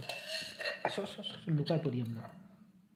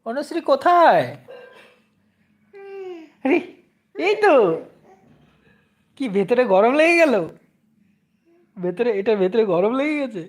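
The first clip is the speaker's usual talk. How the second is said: put-on higher and louder, playfully calling out for the child; mock searching call; 6 semitones higher, 8 dB louder than usual. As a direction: pretend to hunt for the hidden baby.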